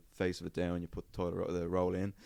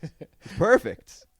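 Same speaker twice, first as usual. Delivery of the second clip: higher, louder, slow delighted gloat; gleeful; 9 semitones higher, 10 dB louder, slower than usual. Retold or imitated. Spotting the unsigned wet floor, slow gleeful gloat. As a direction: relish the chance for a claim.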